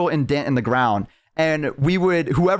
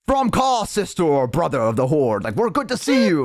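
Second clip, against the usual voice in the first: orc greeting. Retold or imitated. Orc welcoming a fellow orc of the Horde, hearty. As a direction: hail a comrade in an orc voice. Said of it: "if other orcs came up".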